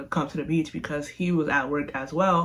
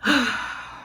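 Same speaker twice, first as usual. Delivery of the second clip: long, heavy exhale; drawn-out fed-up breath; exasperated sigh; 5 semitones higher than usual. Retold or imitated. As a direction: sigh hard before the bad news. Let down before revealing no food.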